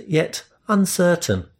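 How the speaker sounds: ordinary delivery, nothing marked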